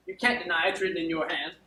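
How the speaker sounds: indignant, building accusation; aggrieved demand. Acted. Wronged steward demanding an explanation from his lady, mounting indignation. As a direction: lay out the evidence with mounting outrage.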